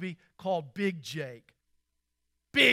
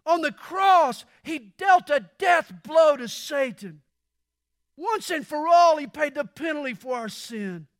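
loud, higher, shouting sermon; fervent preacher's cry; preaching at full force; 7 semitones higher, 8 dB louder than usual. Acted. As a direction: thunder it from the pulpit. Climax of the sermon.

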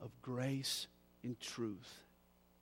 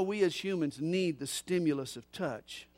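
normal talking voice

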